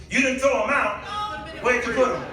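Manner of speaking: ordinary delivery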